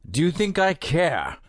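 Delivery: high, scornful, defiant question; defiant retort. A narrator's reading. Prisoner defying the guard who threatens hunger. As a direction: throw it back in his face.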